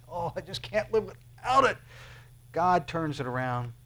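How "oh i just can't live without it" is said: mock longing. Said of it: "the very thing you say".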